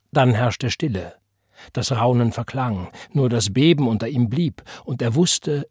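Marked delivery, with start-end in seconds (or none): none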